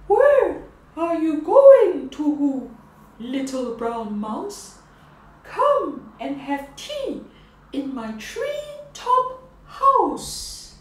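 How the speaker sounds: a grand, hooting owl host. Acted. Owl inviting the mouse to tea, hooting and grand.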